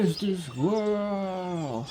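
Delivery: slow, stretched coax; drawn-out call. Real, not acted. Calling the squirrel down, slow and stretched. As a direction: draw the name out sweetly.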